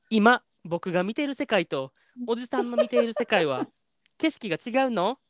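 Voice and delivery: earnest reading of a dreamy letter passage; earnest reading aloud, lightly dramatized. Acted.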